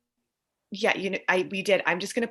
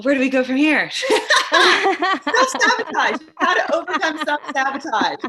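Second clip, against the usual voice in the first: excited; 10 semitones higher, 10 dB louder than usual. Real, not acted. Playful handoff to co-host.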